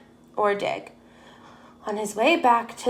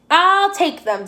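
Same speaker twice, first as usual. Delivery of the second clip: higher-pitched, excited storybook dog; eager exclamation; 7 semitones higher, 4 dB louder than usual. A narrator's reading. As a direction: pitch it up, jumping at the offer. Dog snaps up the skis.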